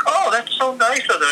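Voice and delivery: mock-innocent high voice; naive delight. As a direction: play it falsely touched. Retold naive pleased reply.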